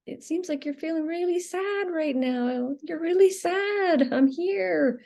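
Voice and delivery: put-on calm a sad child tenderly; soothing parent. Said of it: "hold you and comfort you".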